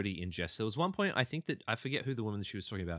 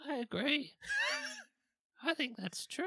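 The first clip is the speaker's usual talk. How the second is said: much higher put-on baby voice. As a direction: pitch way up into a teasing baby voice.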